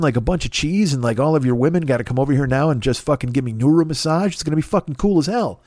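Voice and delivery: ordinary delivery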